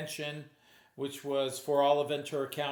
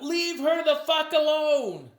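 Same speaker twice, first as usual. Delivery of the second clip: a loud, much higher, slow yell; retold yell; 10 semitones higher, 7 dB louder, slower than usual. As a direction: shout it from the crowd at the hypnotist. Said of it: "someone yelled out".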